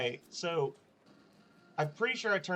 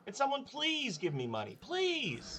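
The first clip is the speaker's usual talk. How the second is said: begging; 9 semitones higher, slower than usual. Acted. Begging crowd for a coin.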